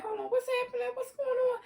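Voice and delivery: high, worried voice of the aunt; a light mock of the aunt catching trouble; aunt's alarm. Aunt Lisa sees the trouble and asks what's happening, high.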